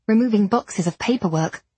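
ordinary delivery

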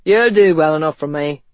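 gruff acceptance, pushed out firmly. Acted. Ethan accepting West as family, gruffly. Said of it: "he said gruffly".

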